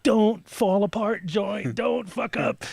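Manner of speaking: high, slow nervous plea to the joint; slow, high, tense inner pleading; anxious plea. Retold or imitated. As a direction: beg the joint under your breath, slow and high.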